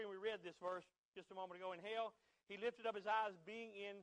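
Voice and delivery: usual speaking voice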